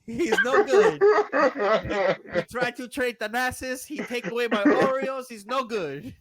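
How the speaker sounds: put-on whiny Greek accent begging the owners; Giannis accent. Giannis complaining to the owners about Doc in Greek accent. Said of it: "Worst accent ever".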